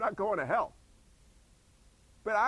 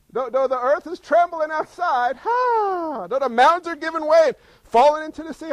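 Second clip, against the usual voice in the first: impassioned preaching; 6 semitones higher, 9 dB louder than usual. Real, not acted. Preaching building to a cry.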